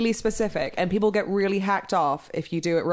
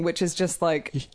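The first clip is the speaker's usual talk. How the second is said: ordinary talk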